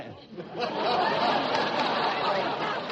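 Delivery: breathy laugh. Real, not acted. Straight man laughs at the television set joke. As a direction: laugh at the dumb answer.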